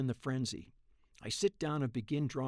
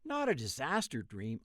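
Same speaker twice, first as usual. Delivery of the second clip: plain reading voice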